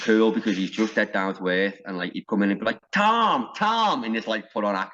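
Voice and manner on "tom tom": silly high-pitched shout for a mate; Warhorse's put-on voice. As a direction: call out a name high-pitched, hamming it up. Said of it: "like, put on voice".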